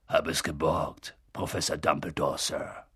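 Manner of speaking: low, rough-hewn voice of a big kind giant; gentle giant Hagrid. A narrator's reading. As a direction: play the humble giant, low and heavy.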